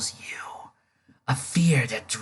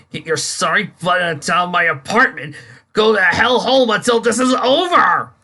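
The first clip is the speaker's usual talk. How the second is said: loud, higher furious yelling; angry shout; 7 semitones higher, 8 dB louder than usual. Acted. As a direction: throw him out with a roar. Boss throws Dick out.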